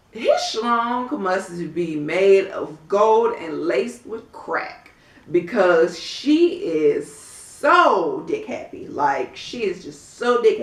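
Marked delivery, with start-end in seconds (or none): 0.1-8.9 slow mocking emphasis. Real, not acted. Mocking Phaedra's infatuation.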